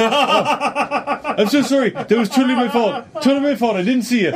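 high, hammed-up profuse apology; mock-distraught and pleading, pitched high; imitated frantic apology. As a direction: gush apologies as the over-sorry driver. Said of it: "he's like".

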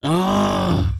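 high, loud exasperated groan; frustrated groan. Acted. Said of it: "groaned Charlie".

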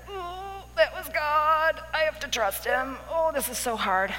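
a drawn-out struggle to obey; strained struggle. Retold or imitated. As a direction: act out finding it painfully hard. Voicing her struggle to trust God.

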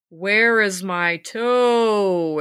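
slow, drawn-out moaning corpse voice; ghostly groan. Acted. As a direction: moan it out like a dead thing searching in the dark. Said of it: "it groaned".

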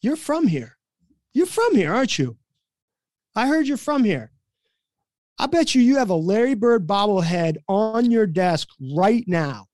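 high, swooping mock interrogation; retold accusation. Player singling out the coach as a local, accusing. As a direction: accuse with exaggerated suspicion, imitating a player. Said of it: "he points his finger right at me".

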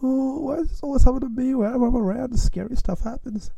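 very high, put-on woeful wail; mock nervous. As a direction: lament your luck in a squeaky falsetto. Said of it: "I got real nervous".

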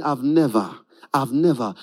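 low, mournful echo of the words; echo of a complaint. Retold or imitated. Remembering a friend's complaint, low and mournful. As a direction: replay the complaint in your head. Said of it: "you're just remembering".

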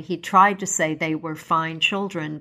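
plain reading voice

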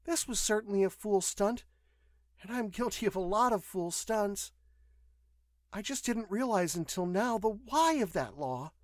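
high-pitched female voice, self-reproachful; contrite young woman. A narrator's reading. Patient admits her fool stunts to nurse, rueful.

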